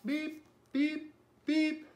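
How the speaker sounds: three high little toots; truck beeps. A narrator's reading. Little blue truck driving off with friends, high beeps. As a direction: beep high, evenly spaced, like a toy horn. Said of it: "said Blue".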